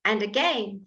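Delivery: ordinary talk, nothing marked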